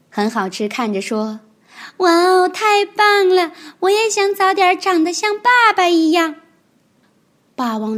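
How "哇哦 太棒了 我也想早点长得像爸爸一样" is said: 高声童声，"太棒了"拖长，"爸"字落下; 崇拜地惊叹; 兴奋惊叹. Acted. 小恐龙看得佩服. (小恐龙高声兴奋地赞叹)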